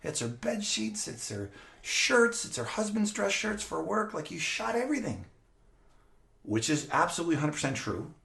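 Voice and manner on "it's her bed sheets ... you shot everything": higher-pitched mock scolding of the kids; scolding grandfather. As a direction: play the fuming grandfather listing the damage. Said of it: "and he says".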